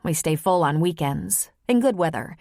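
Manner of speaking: ordinary delivery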